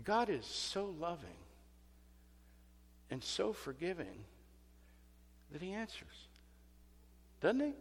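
soft, low, gentle preaching; hushed reassuring sermon; tender sermon. Acted. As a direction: draw them in quietly with tenderness. Preacher drops to intimate tone.

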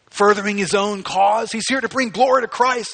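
loud, high fervent preaching; preaching shout. Real, not acted. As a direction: drive it home with force.

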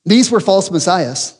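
ordinary talk

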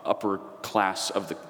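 normal talking voice

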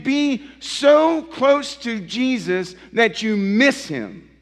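rising pulpit fervour; full-force preaching. Acted. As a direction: preach it out to the congregation.

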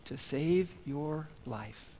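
slow, soft, smiling reply; calm smiling doctor. Retold or imitated. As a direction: quietly reassure him. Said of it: "the doctor just smiles and says".

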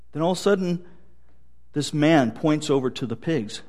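normal talking voice

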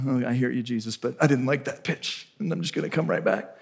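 soft, low, backing-away mumble; sheepish excuse. Retold or imitated. Believer telling Jesus why he didn't run, sheepish.

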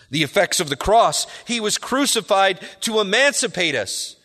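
impassioned sermon climax; fervent preaching. Acted. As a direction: drive the point home from the pulpit. Preaching build on deliverance.

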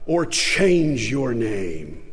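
very slow, dropping to a low close; slow verdict. Retold or imitated. Preacher closes the ultimatum to the congregation, slow and grave. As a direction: let the last words fall heavy.

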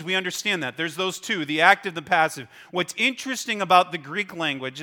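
ordinary talk, nothing marked

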